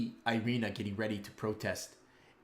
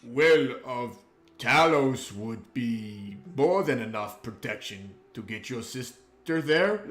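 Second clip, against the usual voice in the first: slow, much louder mock-grand proclamation; pompous booming; 3 semitones higher, 9 dB louder, slower than usual. Renard tries to sound like Talos, slow and booming. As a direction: boom it out like a self-important warrior. Renard speaks after throat clearing.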